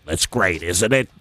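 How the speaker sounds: low put-on Brooklyn accent, swaggering; Brooklyn driver voice. Friend imitating Vegas Phil greeting them, in a low Brooklyn accent. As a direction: play the pushy New York limo driver. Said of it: "you got a really funny voice".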